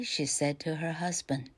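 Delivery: usual speaking voice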